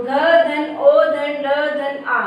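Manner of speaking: very slow, each letter spelled apart; drawn-out phonics breakdown; slow letter-by-letter spelling. Shown as a demonstration. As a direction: spell it out slowly for children. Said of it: "तो घ में ओ की मात्रा".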